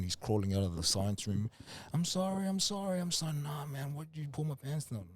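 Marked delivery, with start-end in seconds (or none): 1.9-3.3 high frantic begging; pleading apology. Retold or imitated. Kid on the floor begging the attacker, high and frantic. 3.3-5.0 whiny complaint. Retold or imitated. Hurt kid whining from the floor.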